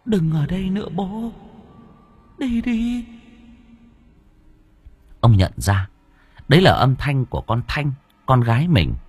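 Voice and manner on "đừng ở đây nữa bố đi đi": high, soft, far-off ghost voice pleading; a dead daughter's thin voice begging her father to go; ghostly child voice. Acted. Ghost daughter urging her father to leave, high and soft.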